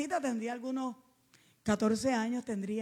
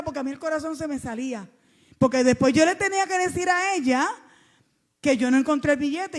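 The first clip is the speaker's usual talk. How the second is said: louder, higher pulpit emphasis; renewed preaching; 3 semitones higher, 6 dB louder than usual. Acted. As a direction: swing back into preaching. Preaching climax after story.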